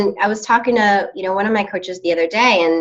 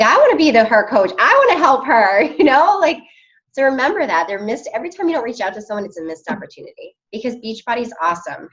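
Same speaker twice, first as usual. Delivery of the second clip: normal talking voice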